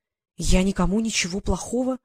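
ordinary delivery

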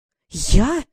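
choked with indignation; a short indignant gasp of a question; mild breathless outrage; outraged gasp. A narrator's reading. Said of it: "Василиса задохнулась от возмущения".

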